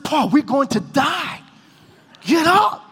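panicked plea. Retold or imitated. Preacher urging his praying friend Paul, panicked and pleading. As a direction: beg him to move before the fight.

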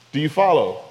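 usual speaking voice

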